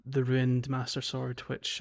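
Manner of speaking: normal talking voice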